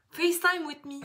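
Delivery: lightly announced show title; title try-out. Pretend. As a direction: float the show name with lifted voice.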